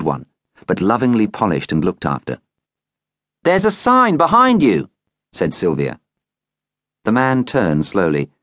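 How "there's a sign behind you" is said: put-on girl's voice. A narrator's reading. Girl points out the sign.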